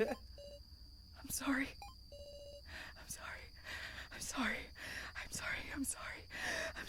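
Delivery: hushed repeated apology; whispering. Acted. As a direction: whisper it, broken. Despairing apology over lost friend.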